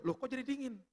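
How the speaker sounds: high-pitched bafflement; surprised retold question. Wife noticing the heat turn cold, surprised. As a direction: imitate a wife's puzzled double take.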